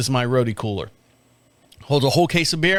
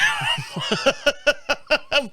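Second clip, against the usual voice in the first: high laugh. Retold or imitated. Retold wife cracking up.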